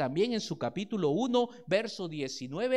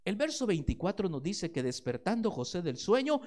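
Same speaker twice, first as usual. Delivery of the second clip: ordinary talk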